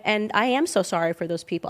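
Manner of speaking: ordinary delivery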